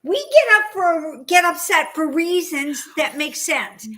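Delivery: high, loud, drawn-out insistence; all worked up. Real, not acted. Woman defending upset women to a friend, worked up. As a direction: press each point home, worked up. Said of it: "I'm getting myself all worked up".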